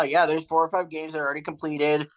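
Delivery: ordinary talk, nothing marked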